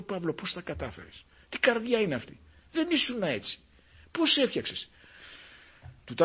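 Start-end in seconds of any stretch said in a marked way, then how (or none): 0.0-4.9 higher, softer amazed questioning; astonished questioner. Retold or imitated. The preacher recalls asking Paul, amazed and high. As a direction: ask in wonder, voice lifted high. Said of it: "Λέω".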